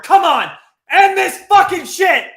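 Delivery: high, loud pleading yell; screamed impatience in stoppage time; desperate shout. Real, not acted. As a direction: beg the ref to end it. Desperate for final whistle.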